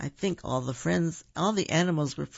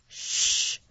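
long whispered shush; hushing shh. A narrator's reading. Narrator hushes children near sleeping bear, long shh. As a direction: hush the listeners with a drawn-out shh.

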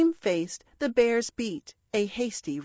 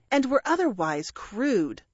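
ordinary delivery

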